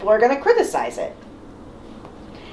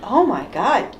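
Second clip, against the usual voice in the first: normal talking voice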